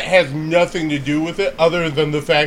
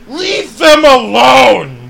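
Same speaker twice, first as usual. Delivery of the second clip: higher, louder mock yell at rowdy strangers; mock shout; 10 semitones higher, 11 dB louder, slower than usual. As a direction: yell it like an annoyed bar patron. Other patrons snapping at group.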